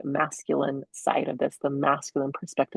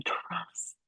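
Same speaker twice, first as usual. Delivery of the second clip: hushed, breath-only urging; very quiet whispered prompting; 2 semitones higher, 13 dB quieter than usual. Retold or imitated. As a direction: whisper it softly and gently. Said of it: "they whisper".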